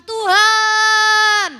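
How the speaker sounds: high, loud, stretched plea; crying out to God. Retold or imitated. Preacher acting her own plea to God, loud and high. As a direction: call to God like a cry for help. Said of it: "Setiap saya teriak minta tolong".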